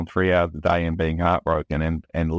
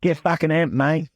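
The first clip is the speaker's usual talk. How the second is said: mock Aussie accent; 9 semitones higher than usual. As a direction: shout it like a brash Aussie bloke. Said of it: "Try to make us sound Aussie".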